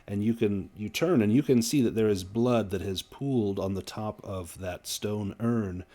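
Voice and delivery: ordinary talk, nothing marked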